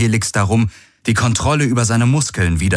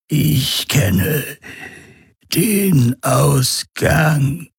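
higher, slow eerie promise; alien voice; 8 semitones higher, much slower than usual. A narrator's reading. Urus Khan tempts Galen over the comm, slow eerie voice. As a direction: draw out the last word like a lure. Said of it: "die Stimme des Urus Khan".

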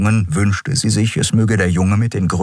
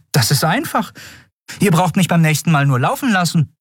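high-pitched character voice; Endriel's cheeky retort; 11 semitones higher than usual. Acted. Prisoner Endriel to the admiral who warned her, cheeky. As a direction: toss it back in a high voice.

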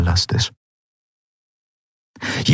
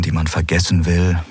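usual speaking voice

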